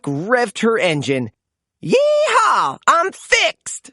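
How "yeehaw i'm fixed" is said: very high, raised yeehaw; cowgirl whoop. A narrator's reading. Cowgirl truck whooping after being fixed, very high.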